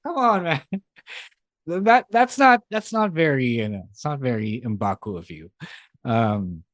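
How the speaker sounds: usual speaking voice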